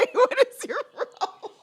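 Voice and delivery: mock telling-off of her own tears, laughing; laughing self-mock. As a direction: tease yourself through a laugh. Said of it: "I'm like".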